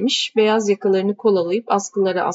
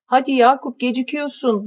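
high, loud call from another room; calling from inside; 6 semitones higher, 4 dB louder than usual. Acted. Wife calling from inside to hurry her husband. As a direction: hurry him along from indoors. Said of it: "diye bağırdı kadın içeriden".

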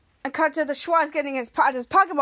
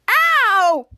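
long, high, loud "ow"; imitated pain cry; 5 semitones higher, 7 dB louder than usual. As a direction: yelp as the injured character. Retold character hit with chair.

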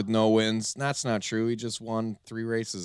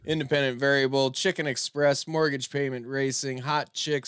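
lively race-calling announcer voice; 7 dB louder than usual. Acted. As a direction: call the chicken race like live sports. Holds race announcer calls.

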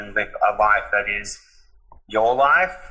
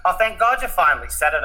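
higher-pitched mock relief; relieved exclamation; 8 semitones higher, faster than usual. Mum who has known for years, relieved at last. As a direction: retell mum's relief, pitched up.

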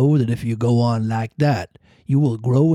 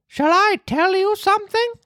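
Pinocchio voice. A narrator's reading.

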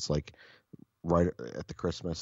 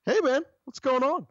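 high, loud, chirpy "hey man"; breezy boss greeting; 7 dB louder than usual. Retold or imitated. Boss phoning his employee, falsely cheerful. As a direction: sing it out like nothing's wrong.

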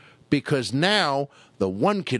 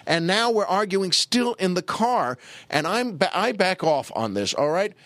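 ordinary talk, nothing marked

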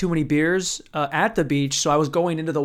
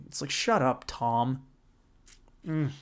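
ordinary talk

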